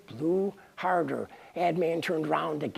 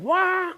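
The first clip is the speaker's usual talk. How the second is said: mock baby wail; 14 semitones higher, 4 dB louder than usual. God's son punched in the side.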